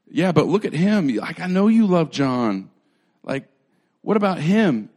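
loud, high mock protest; hammed-up jealous objection, pitched high; indignant complaint. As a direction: whine loudly about the favourite. Peter acted complaining about John.

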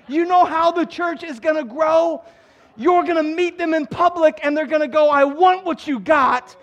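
high, loud, insistent sermon delivery; impassioned preaching. Real, not acted. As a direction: drive the point home to the congregation. Preaches at full force.

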